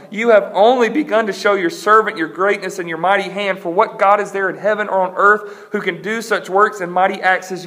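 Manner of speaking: impassioned reading of a prayer; pleading prayer. Acted. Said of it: "He's pleading".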